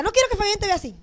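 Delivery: voz aguda y rápida, imitando a la pastora; regaño imitado de la pastora. Retold or imitated. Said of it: "ella me decía". (regaño agudo, en imitación)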